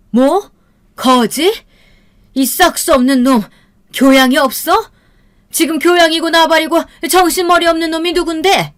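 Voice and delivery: loud, high-pitched tirade; acted outraged shouting; furious mother. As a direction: throw his insults back at him angrily. Mother explodes at son-in-law.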